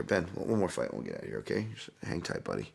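soft word to a pet; quiet aside. Real, not acted. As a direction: drop the volume to soothe the dog. Aside to his waiting dog.

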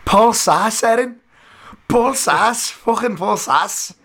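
high, excited put-on Scouse accent; gushing the name in a hammed-up Scouse accent; mock Scouse accent. Co-host hails the fighter's name in mock Scouse accent. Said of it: "that poor accent".

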